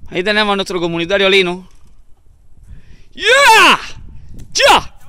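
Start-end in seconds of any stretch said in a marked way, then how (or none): 3.1-5.0 very high, loud yell; shouting out. Real, not acted. Hiker yelling to his friend Lino, very high and loud. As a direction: holler across the hillside.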